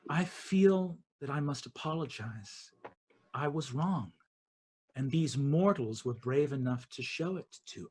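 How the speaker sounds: soft, low contrite voice; Queen of Dusk admits she was wrong, quietly; quiet apology. Acted.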